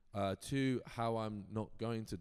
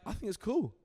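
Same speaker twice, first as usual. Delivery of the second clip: high, louder voicing of the agent; agent's approval; 12 semitones higher, 6 dB louder than usual. Agent approving the writer's unusual style, lightly imitated. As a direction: imitate her breezy okay, pitched well up.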